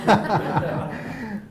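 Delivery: breaks into a real laugh, enjoying herself; amused laugh. Real, not acted. Narrator laughing at her own reading. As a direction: laugh it off with delight.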